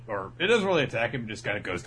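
ordinary talk

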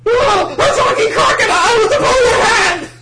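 very high, loud crying shriek; Usopp shriek. Retold or imitated. Usopp panicking at the crocodile, high crying shriek. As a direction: wail it like a panicked coward. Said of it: "Is Usopp".

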